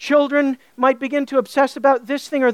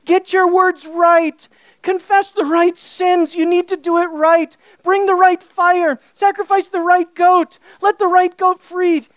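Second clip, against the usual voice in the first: frantic urgent warnings; 5 semitones higher, 3 dB louder than usual. Acted. Preacher voicing the anxious rules for the priest, urgent and loud. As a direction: hammer each rule home like everything depends on it.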